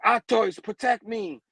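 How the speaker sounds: high call for protection; acted appeal for help; urgent command. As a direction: call out to your helpers urgently. Villain commanding his arms.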